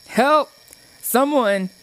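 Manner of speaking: high, loud shout for help; crying for help. A narrator's reading. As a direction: yell out in panic. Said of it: "she heard Brody cry".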